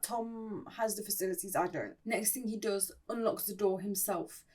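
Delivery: normal talking voice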